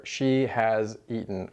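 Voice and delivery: slow, deliberate It has been; slow teacher demo; slow example. Shown as a demonstration. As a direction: spell It has been out word by word. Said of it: "in the same way that we say in English".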